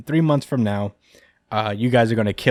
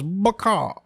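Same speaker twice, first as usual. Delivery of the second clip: high squawked Baka; high cartoon chicken cry of Baka; chicken devil squawk; 9 semitones higher than usual. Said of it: "Bucky goes".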